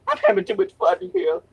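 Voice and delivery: high, quick giddy line; giddy self-quote. Retold or imitated. Streamer quoting his giddy stream self, high and quick. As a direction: bubble over with fun. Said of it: "I'm just like".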